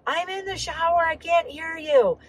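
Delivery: high-pitched yell from the shower; retold scream. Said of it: "and I, I screamed".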